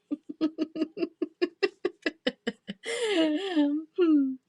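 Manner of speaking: high laugh close to crying; tearful laughter. Real, not acted. Seller laughing at the tangled name, near tears. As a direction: laugh until it nearly turns to tears.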